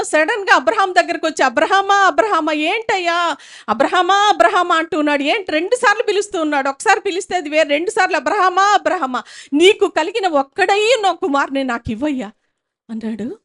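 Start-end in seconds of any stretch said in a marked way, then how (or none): 1.4-4.8 calling out. Acted. God calling Abraham. 8.2-12.3 calling out. Acted. God calling Abraham.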